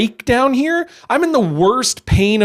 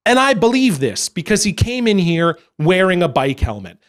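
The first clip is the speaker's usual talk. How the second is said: normal talking voice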